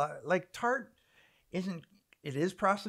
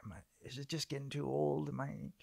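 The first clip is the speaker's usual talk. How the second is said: quiet self-doubt; 4 semitones lower, 10 dB quieter, slower than usual. Retold or imitated. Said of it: "you're like".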